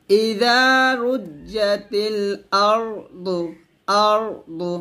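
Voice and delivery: আরদু with heavy র and দ; thick আরদু; correct pronunciation demo. Shown as a demonstration. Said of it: "দতটা মোটা করবেন". (আরদু মোটা করে)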